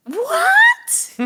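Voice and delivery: very high, loud squeaky "What?"; mock astonishment at her own story; a high, loud put-on squeal; shocked squeal. Shocked exclamation after laugh.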